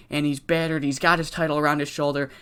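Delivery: ordinary talk, nothing marked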